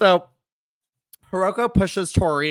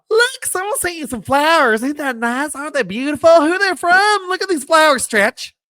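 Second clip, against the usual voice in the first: higher, sing-song sweet voice; sing-song Michelle; 8 semitones higher, 3 dB louder than usual. Retold or imitated. Retelling Michelle handing over flowers, higher and sweet. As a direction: play up a sugary mean girl. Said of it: "Michelle McCool comes in".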